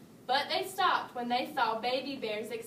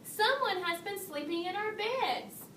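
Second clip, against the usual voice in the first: higher, mild exclaim; lightly alarmed parents; 6 semitones higher than usual. A narrator's reading. Both parents finding their beds slept in, mild exclaim. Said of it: "both his parents exclaimed".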